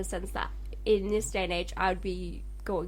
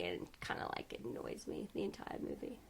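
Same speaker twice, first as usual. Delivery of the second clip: half-whispered, under the breath; a soft unvoiced mutter of annoyance; muttered aside; 8 semitones lower, 15 dB quieter than usual. Real, not acted. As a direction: grumble it quietly to yourself. Muttered complaint about the character.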